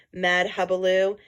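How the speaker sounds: usual speaking voice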